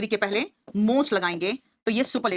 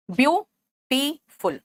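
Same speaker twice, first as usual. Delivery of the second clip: "Beautiful" very slow, split as byu-ti-ful; broken into syllables; much slower than usual. Shown as a demonstration. Teacher showing students syllables, word broken up slowly. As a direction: pause between each syllable of "beautiful". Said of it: "शब्द के खंड में तोड़ोगे".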